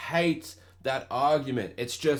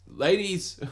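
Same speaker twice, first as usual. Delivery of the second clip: a raised call to the ladies, higher; 7 semitones higher than usual. Acted. First try at a comedian's call to women listeners. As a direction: hail the ladies like a comic. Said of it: "I'm gonna Jack Bill Burr here".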